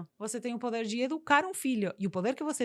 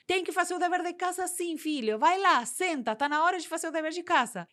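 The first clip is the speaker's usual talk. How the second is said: higher, slightly raised voice of a parent insisting; 8 semitones higher, 5 dB louder than usual. Acted. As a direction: push the kid gently but firmly to homework. Parent insisting after child's tantrum.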